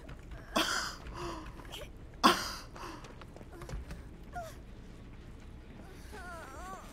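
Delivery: long high wheezing laugh; breathless laugh. Real, not acted.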